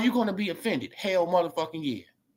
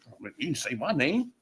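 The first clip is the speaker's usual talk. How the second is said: soft, lower mocking whine; mock whiny excuse; 3 semitones lower, 5 dB quieter than usual. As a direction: make fun of the excuse, quietly.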